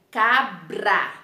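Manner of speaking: CABRA broken into "ca-bra", tapped R after the B; syllable-by-syllable demo. Shown as a demonstration. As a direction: sound out CABRA in two beats. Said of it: "olha o som que você forma".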